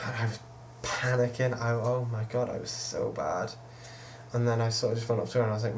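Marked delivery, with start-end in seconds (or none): none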